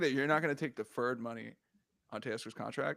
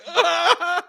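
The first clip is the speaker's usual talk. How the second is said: high loud laughing wail; mock fan wail; 12 semitones higher, 10 dB louder than usual. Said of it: "they're just like".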